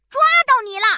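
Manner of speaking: loud triumphant shout. Acted. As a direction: yell it as you catch him. Hamster catches the thief.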